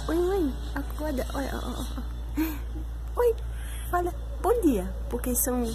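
hushed voice by sleepy babies. Real, not acted. Mother on the sofa talking quietly beside waking babies. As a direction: talk low so the twins stay calm.

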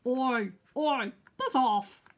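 loud, grumpy dog voice; growling grumpy dog. A narrator's reading. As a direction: bark it out to shoo the bee away. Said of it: "growled a grumpy dog".

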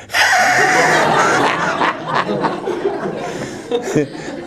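breathy extended laughter; real long laugh. Real, not acted. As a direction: laugh at the punchline. After the punchline about the accent.

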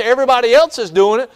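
high and fast, loud; eager fly excuse. Retold or imitated. Fly excusing himself to the bee, high and fast. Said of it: "He says".

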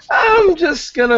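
high, loud flustered squeak. Acted.

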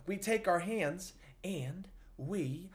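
hushed secret whisper. Pretend. Entertainer whispers to the kids during the routine. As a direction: drop to a playful whisper.

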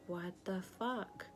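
slow, quietly stunned; stunned disbelief. Retold or imitated. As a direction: draw each word out in mild disbelief. Retold shock at the news.

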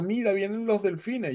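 high excited call to look behind; excited pointing out. Retold or imitated. Rescuer announces dolphins coming.

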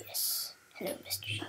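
whispered greeting to a sheep; whispering. Pretend. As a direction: whisper hello sweetly.

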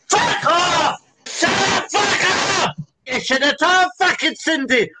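high angry shouting; furious dismissal. Real, not acted. Silencing someone in a row, furious shout. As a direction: shout them down hard.

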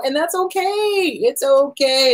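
high, drawn-out and soothing; slow sing-song comfort; lilting reassurance. Real, not acted. Therapist reassuring readers who switch books, high lilting comfort.

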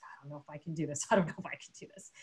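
soft, quick, low mock muttering; muttered self-doubt. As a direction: act out doubting yourself under your breath, rapidly. Retold private doubt on each step.

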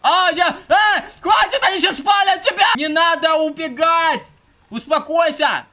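high, loud alarmed yelling; panicked shouting. Real, not acted. Streamer panicking as his character flees, shouting. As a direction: shout in panic to stop him.

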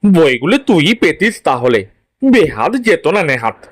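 father's voice, pressing his son; a dad laying out what could have been won; insistent father. Acted. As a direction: voice a father urging his boy to compete. Father figure in story.

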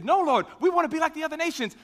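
high, rushed protest; mock-insistent crowd demanding their way. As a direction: insist on it, pitched right up, in a hurry. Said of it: "they insisted".

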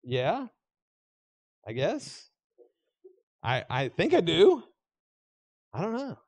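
mock-sheepish answer to Jesus asking do you love me; unsure mumble. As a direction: hedge like you're caught out. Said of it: "I know what I would say".

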